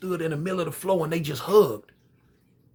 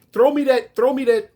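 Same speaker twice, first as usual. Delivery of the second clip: hollering; 6 semitones higher, 3 dB louder than usual. Retold or imitated. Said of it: "she hollered up".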